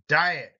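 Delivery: DI-et, first syllable hit; first syllable stress. Shown as a demonstration. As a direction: lean on the front of diet. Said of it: "first syllable emphasis".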